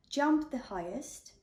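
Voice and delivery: ordinary delivery, nothing marked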